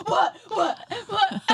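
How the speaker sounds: loud, high repeated yelps of confusion; mock-alarmed bursts with laughter creeping in; startled awake. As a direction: imitate a jolted sleeper while cracking up. Startled husband retold through laughter.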